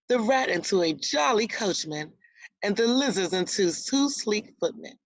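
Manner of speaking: ordinary delivery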